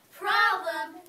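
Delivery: loud rising cry; loud exclamation. Acted. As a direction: blurt it out. Wolf exclaims.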